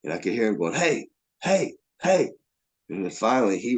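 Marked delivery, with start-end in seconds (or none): 0.7-2.3 short high-pitched heys; imitated calling out. Stranger hollering at a man who keeps walking, imitated calling out. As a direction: call after someone walking away. Calling after him to stop.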